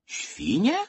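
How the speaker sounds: high, rising puzzled question; lightly voiced astonished little boy; surprised. A narrator's reading. Little boy questions the wild pigs, surprised. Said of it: "zdziwił się Wojtuś".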